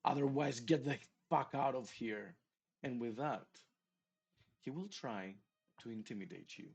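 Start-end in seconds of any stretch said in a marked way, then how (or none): 0.0-2.3 loud, raised, furious roar; hoarse intimidating bark. Acted. Game master as Caleb intimidating Sir Christopher, gruff furious bark. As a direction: bark threats in a gruff voice, hammering each phrase. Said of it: "as he barks in a rather hoarse and dark voice, gruff voice".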